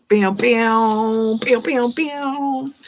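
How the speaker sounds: rising "pew pew" sound effects; cartoon zap sounds; playful zap noises. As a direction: imitate little laser zaps, climbing in pitch. Playful noises while dealing cards.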